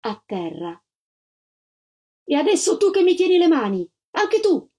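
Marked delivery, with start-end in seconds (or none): 2.2-4.7 high, shrill-pitched panicked maid; a maid's high panicked cry; frantic maid. A narrator's reading. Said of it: "fece la cameriera".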